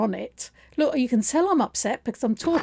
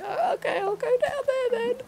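high, tearful whimper, much higher; mock crying; 13 semitones higher than usual. Telling the receptionist she'll go down, in a mock tearful whimper. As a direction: play a small scared voice on the verge of tears.

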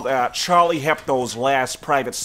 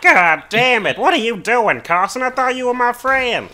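high, loud, hurt cartoon-character voice; put-on cartoon voice; 12 semitones higher, 7 dB louder than usual. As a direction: whine it out as a betrayed cartoon friend. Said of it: "I do voices all the time".

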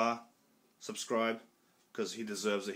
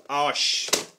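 higher, louder burst of "Oh shit"; excited yelp; 5 semitones higher, 9 dB louder than usual. Real, not acted. Collector suddenly spots the blue steelbook and yelps with joy. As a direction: blurt it out in a rush of excitement. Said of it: "I'm excited".

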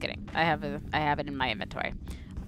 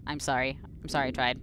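ordinary delivery

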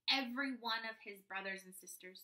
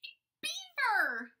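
loud, high and slow reveal; delighted big reveal; excited reveal; 11 dB louder than usual. A narrator's reading. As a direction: draw out the surprise answer.

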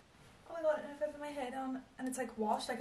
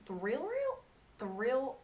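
American accent; slower than usual. Real, not acted. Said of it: "I've been sounding so American".

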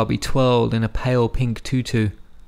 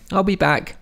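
calling out; 8 semitones higher than usual. A narrator's reading. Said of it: "he called to Zalmay".

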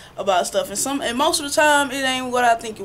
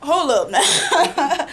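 slow, drawn-out hold up, louder and laughing through it; 4 semitones higher, 5 dB louder, slower than usual. Retold or imitated. Retelling how she stopped a pushy person, laughing and louder. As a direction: put on the brakes with a laugh. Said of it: "I'm like".

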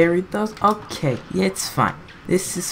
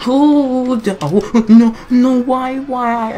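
anguished cries; 8 semitones higher, 5 dB louder, much slower than usual. Real, not acted. Dies on final phase.

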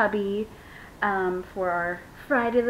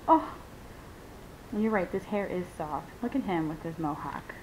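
normal talking voice

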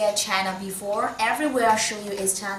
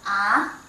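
Ah short, sweeping upward; rising second tone. Shown as a demonstration. Teacher reviewing tones in order, rising Ah. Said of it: "From first tone to last tone".

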